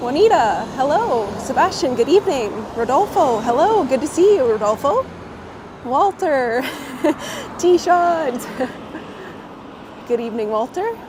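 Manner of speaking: ordinary delivery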